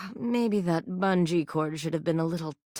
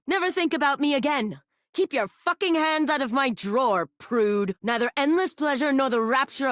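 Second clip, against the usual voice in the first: higher, fast and cutting; pitched-up furious brush-off; high angry dismissal; 7 semitones higher than usual. Acted. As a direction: slam the door on them. Spurned character snapping at player.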